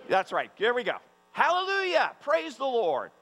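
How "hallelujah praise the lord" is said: hallelujah shouted out high; exultant praise. Real, not acted. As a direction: lead the room in joyful praise. Leading congregation praise.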